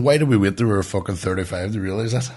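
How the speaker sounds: usual speaking voice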